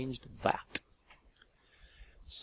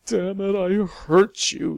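anguished outburst; 9 semitones higher, 10 dB louder than usual. Acted.

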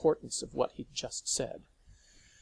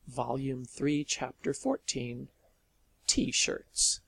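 plain reading voice

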